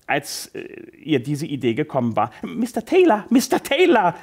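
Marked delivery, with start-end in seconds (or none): 2.4-3.2 high, eager call to the boss; aufgeregter Ausruf. Acted. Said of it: "platzte es deshalb sofort aus ihr heraus". 3.3-4.1 much higher and louder second call; lauter Ausruf. Acted. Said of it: "platzte es deshalb sofort aus ihr heraus".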